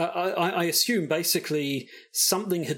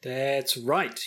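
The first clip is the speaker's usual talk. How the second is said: normal talking voice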